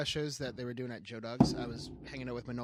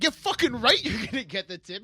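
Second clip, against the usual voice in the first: loud, high indignant snap; hammed-up outrage; shouting it back at her; mock angry; 9 semitones higher, 9 dB louder than usual. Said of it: "he's like".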